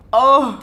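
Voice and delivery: sudden loud sneeze; a sharp sneeze bursting out; sneezing. Real, not acted. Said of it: "j'ai éternué".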